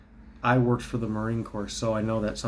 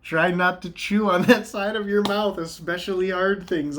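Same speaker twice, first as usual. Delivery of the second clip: laughing; 8 semitones higher, 4 dB louder than usual. Retold or imitated. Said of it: "He also says".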